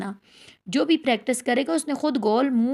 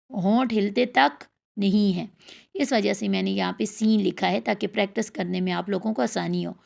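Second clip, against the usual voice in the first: usual speaking voice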